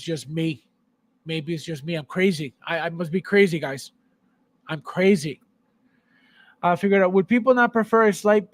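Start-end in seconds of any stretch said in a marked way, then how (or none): none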